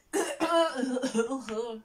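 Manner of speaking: loud coughing fit; choking cough. Real, not acted. Vlogger chokes mid-video, loud real coughing. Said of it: "C'est l'étouffement".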